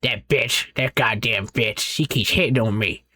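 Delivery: a hint of a cranky old man, slowed; old man ranting. A narrator's reading. Grandfather rants about the nurse, a hint of cranky old man. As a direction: grumble it like a bitter grandpa.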